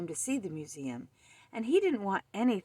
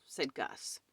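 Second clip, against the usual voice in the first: ordinary delivery, nothing marked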